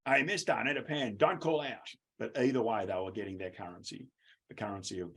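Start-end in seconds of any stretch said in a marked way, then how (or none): 0.1-1.9 loud student call then a sharp higher teacher reply. Retold or imitated. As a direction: shout it across a noisy class.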